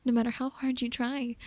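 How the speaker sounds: usual speaking voice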